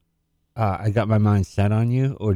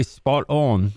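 imitated accent on a catchphrase, pitched higher; Alex Whiteley accent; 4 semitones higher than usual. Co-host quotes an acquaintance's catchphrase in their accent. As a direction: lightly put on someone else's accent. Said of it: "Alex Whiteley would say".

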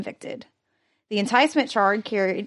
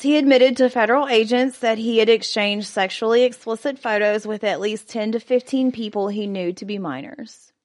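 usual speaking voice

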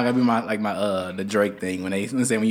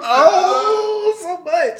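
crying with laughter; 4 dB louder, much slower than usual. Real, not acted. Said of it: "I'm crying".

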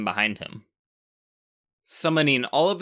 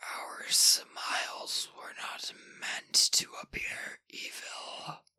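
slow rasping whisper of an ancient creature; ancient dusty rasp; 10 dB quieter, slower than usual. Acted. Ancient creature warning the boy, slow rasping whisper. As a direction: breathe it out like dust from a tomb.

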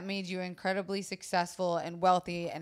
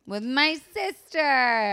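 slow, loud, high quip; slow, loud teasing aside; drawn-out tease; 5 semitones higher, 5 dB louder, slower than usual. Acted. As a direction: stretch it out for comic effect. Daughter adds dramatic correction.

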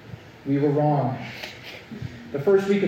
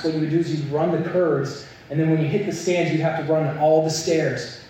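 usual speaking voice

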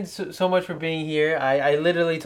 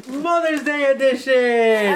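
slow, higher showman reveal; excited announcement; 7 semitones higher, slower than usual. Acted. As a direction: stretch it out like a big reveal. Host announces special edition.

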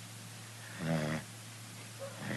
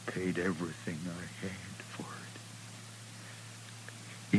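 whispering; 10 dB quieter, faster than usual. Acted. Dying man's last words.

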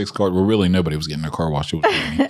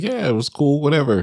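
high, breezy, offhand imitation; casual shrug; 8 semitones higher than usual. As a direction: toss it off like a laid-back stranger. Stranger's casual reply imitated.

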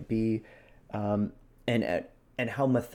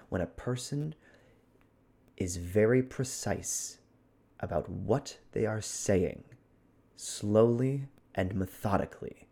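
threateningly precise; 3 dB quieter than usual. Shown as a demonstration. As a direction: measure out each phrase with quiet menace. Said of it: "could be very threatening".

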